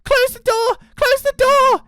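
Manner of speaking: put-on very high, loud child's yell; panicked shout. A narrator's reading. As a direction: shriek the warning urgently. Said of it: "shouted Jackie".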